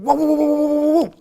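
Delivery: high, loud rapid mock gunfire; spray the sound out; machine gun burst. Man miming machine gun fire at a lion, loud bursts.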